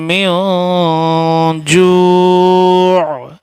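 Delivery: slow melodic chant with long held notes; tajweed recitation. Shown as a demonstration. Teacher showing students the right way in melodic recitation. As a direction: chant the verse, stretching the last word. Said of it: "صحیح طریقہ کیسے پڑھنے کا".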